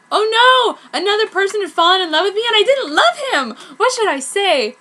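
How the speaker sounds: loud, high flustered panic; panicked. Acted. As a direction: flap in alarm. Panicked inner monologue.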